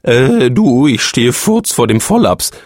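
laut ansetzendes, langsames Ablenken; Ausweichen. A narrator's reading. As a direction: lenk hastig vom Thema ab. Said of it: "versuchte er das Thema zu wechseln". (der Phönix wechselt verlegen das Thema)

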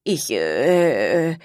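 low, halting stammer; stammering witch. Acted. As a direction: choke on the words, lost for what to say. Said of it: "stotterte die Hexe".

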